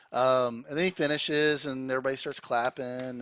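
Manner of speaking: ordinary talk, nothing marked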